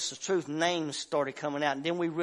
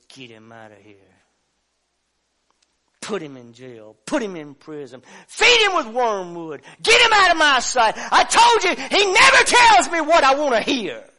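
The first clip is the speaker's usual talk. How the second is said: king raging at the prophet; angry shout; 7 semitones higher, 15 dB louder than usual. Retold or imitated.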